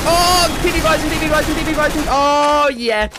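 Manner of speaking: high, loud gleeful chant; excited whooping. Real, not acted. Gamer cheering on his P P Bison, excited whooping.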